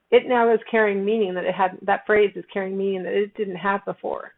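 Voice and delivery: ordinary talk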